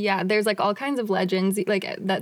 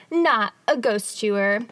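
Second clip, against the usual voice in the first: NOT drawn out and set apart; all-caps emphasis; slower than usual. Retold or imitated. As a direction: read it like all caps, leaning hard on "Not". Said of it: "they put in all caps".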